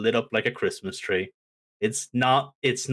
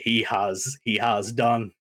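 ordinary talk

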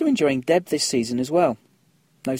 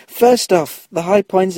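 laughing; 6 semitones higher, 7 dB louder, slower than usual. Real, not acted. Breaks up laughing on retake.

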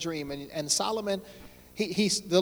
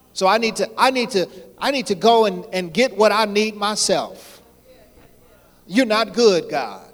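loud preaching; 3 semitones higher, 7 dB louder than usual. Acted. Voicing the cheating believer.

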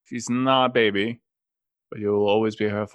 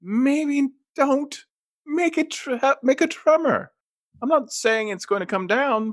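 high, raised, incredulous; exasperated scolding; 9 semitones higher than usual. Real, not acted. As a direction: tell them off for the tremor. Player scolds about the dead.

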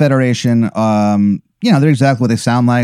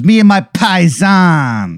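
put-on slow, higher mobster voice in an Italian-American accent; 6 semitones higher, slower than usual. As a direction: stretch out Me and my paisans like a wiseguy with an Italian-American accent. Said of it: "He goes".

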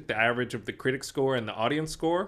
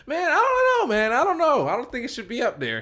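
imitating a doubter in a much higher voice; high-pitched, louder mock objection; tripping, mock protest; 12 semitones higher, 6 dB louder than usual. Host mimicking his co-host's doubts about Heat, mock protesting. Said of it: "he was tripping on Heat".

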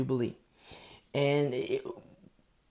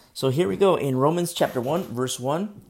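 much louder, calling it out; loud announcement; 3 semitones higher, 9 dB louder than usual. Real, not acted. Preacher announcing the reading of Romans, loudly. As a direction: call the text out to the room.

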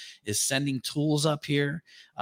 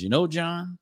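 Southern drawl; 6 semitones higher than usual. Retold or imitated. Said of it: "in his deep Southern drawl voice".

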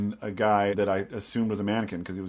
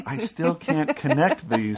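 slow, baffled deadpan; drawn-out confused reply; puzzled; 6 semitones higher, much slower than usual. Retold or imitated. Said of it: "And I was like".

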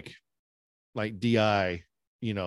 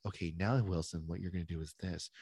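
softer, mildly patient instruction; mock gentle instructor; 3 semitones lower, 8 dB quieter than usual. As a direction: give a quiet, polite order with no force. Imagined gentle instructor instead of screamer.

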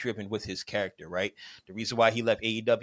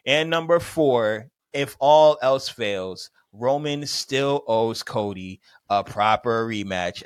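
louder, slow, each word landed hard; slow emphatic closer; 6 dB louder, slower than usual. Acted. Host wrapping up his argument, slow and emphatic. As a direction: deliver the final point like a keynote. Big finish of his ted talk.

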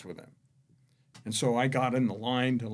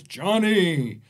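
big booming voice from above; 8 semitones higher, 10 dB louder, slower than usual. Retold or imitated. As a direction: boom it out like God from the rafters. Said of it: "this giant booming voice".

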